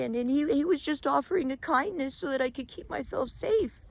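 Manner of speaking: scared Wolf. Acted. Frightened Wolf stammering his excuse to Bear. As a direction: stumble out excuses in fear.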